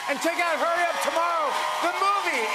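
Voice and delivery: loud, high show announcer; TV host announcer. Acted. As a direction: hype it up for the studio crowd.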